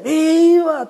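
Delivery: high, loud, held cheer; crowd's answering shout. Acted. All the townsfolk answering the grito, acted shout. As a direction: shout it back like a whole crowd. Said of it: "Todos gritan".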